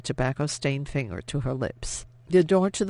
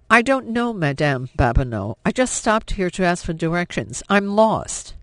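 louder, higher pleading lie; desperate denial; 4 semitones higher, 6 dB louder than usual. A narrator's reading. Cornered woman pleads she is only lost, frightened. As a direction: push the lie out in a rush of fear. Woman denies under rough interrogation.

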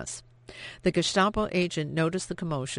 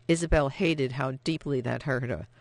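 ordinary delivery

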